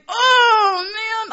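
loud, high-pitched wail; anguished dismay. Retold or imitated. Daughter after angering her mom, wailing in dismay. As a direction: cry out as if sick with guilt. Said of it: "Like, I felt terrible".

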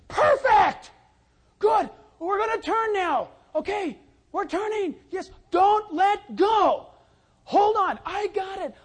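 high, loud excited encouragement; excited cheering. Retold or imitated. Pastor cheering the boy through the turn, excited shouting.

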